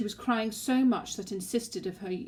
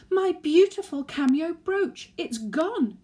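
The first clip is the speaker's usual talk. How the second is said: high, loud, near-tearful cry; tearful wail; 11 semitones higher, 10 dB louder, slower than usual. Acted. Granny lamenting her lost brooch to the family, loud tearful wail. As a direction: wail over the lost brooch, eyes welling. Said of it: "Tears welled in her eyes".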